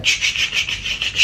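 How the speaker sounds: whispered cut-out noise, like a censor bleep; mock editing bleep. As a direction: hiss out a fast edit-cut sound. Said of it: "We'll be like".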